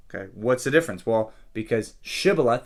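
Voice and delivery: usual speaking voice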